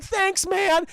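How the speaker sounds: high, loud cartoon slacker thanks; Shaggy. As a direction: toss it out in a goofy sidekick voice. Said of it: "And Shaggy's like".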